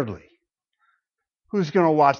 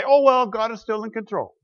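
mock resigned shrug; 8 semitones higher, 4 dB louder than usual. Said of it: "go back up into the stands and say".

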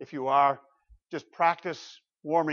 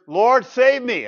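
desperate cry; 8 semitones higher, 11 dB louder, slower than usual. Retold or imitated.

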